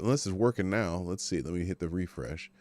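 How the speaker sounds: ordinary talk, nothing marked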